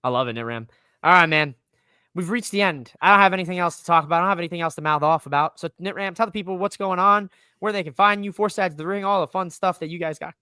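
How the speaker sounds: ordinary delivery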